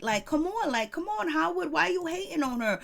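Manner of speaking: high-pitched, exasperated appeal; playfully chiding, pitched well up; mock remonstration. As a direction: plead with an absent star to quit it. Chides absent Howard Stern directly.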